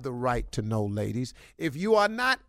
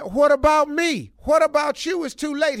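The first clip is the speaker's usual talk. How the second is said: higher, louder cry of the jilted wife; mock wife's plea; 8 semitones higher, 5 dB louder than usual. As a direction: squeal the wife's hurt question. Wife voiced, dumped.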